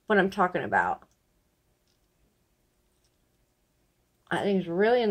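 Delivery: pulling out dentures while talking; speaking around own fingers; fingers in mouth. Real, not acted.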